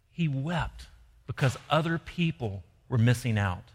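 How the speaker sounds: usual speaking voice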